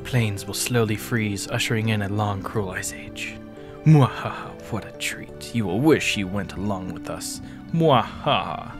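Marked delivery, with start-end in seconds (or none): 3.8-4.5 evil laugh. Acted. Villain laugh. 7.7-8.7 evil laugh. Acted. Villain laugh.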